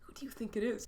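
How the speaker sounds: soft, whispered question; hushed whisper. Acted. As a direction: whisper it into a silent room. Hushed aside after silence falls.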